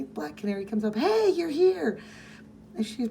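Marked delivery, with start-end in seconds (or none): 1.0-2.0 cheery greeting. Retold or imitated. Canary greets a visitor.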